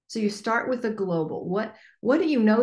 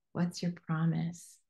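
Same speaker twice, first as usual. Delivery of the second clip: quiet repeat; 2 semitones lower, 8 dB quieter, slower than usual. Shown as a demonstration.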